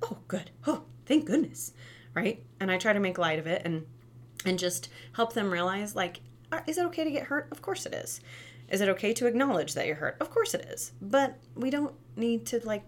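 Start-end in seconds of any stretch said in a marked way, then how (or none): 0.1-1.7 light, high mock relief. Parent reacting playfully. 6.5-10.9 put-on reassuring kid. Shown as a demonstration. Reassuring child after a fall.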